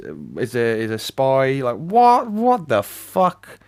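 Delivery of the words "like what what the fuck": high-pitched, drawn-out disbelief; incredulous outburst. Real, not acted. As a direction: stretch it out high in sheer disbelief. Incredulous at the plot.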